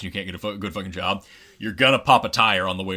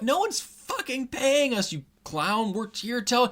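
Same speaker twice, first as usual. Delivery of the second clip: much higher, slow incredulous retort; outraged kids. Retold or imitated. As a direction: fire back in disbelief. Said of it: "they're like".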